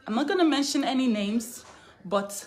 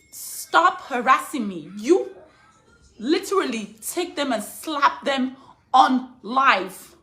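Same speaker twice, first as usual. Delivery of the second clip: loud, slow, furious demand; angry; 3 semitones higher, 6 dB louder, slower than usual. Real, not acted. Mother shouting at people reporting her, furious.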